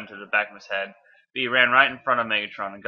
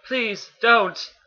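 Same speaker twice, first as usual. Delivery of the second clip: high-pitched, pleading Bumblebee voice; in-character plea; 12 semitones higher, 3 dB louder than usual. Acted. Reader pleading in Bumblebee's character voice, high and earnest. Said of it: "Gotta get in the character".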